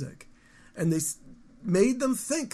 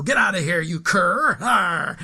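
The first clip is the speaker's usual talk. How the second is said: louder, snarling mock yell; angry shout at dog; 7 dB louder, slower than usual. Tired man home from work shouting at his dog, angry and loud. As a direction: bark it out like a fed-up man after a bad day. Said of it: "they look at the dog and go".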